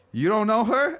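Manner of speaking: very high, loud disbelieving question; incredulous guy. Retold or imitated. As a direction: realize she's a stranger, stunned.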